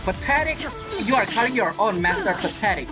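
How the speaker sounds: usual speaking voice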